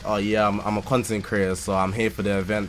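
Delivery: nonchalant. Retold or imitated. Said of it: "I switched the nonchalant trait".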